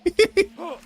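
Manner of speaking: a short high-pitched giggle. Real, not acted. Giggling after quoting the meme to chat, high and light. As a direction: giggle at the bit. Laughing at own impression.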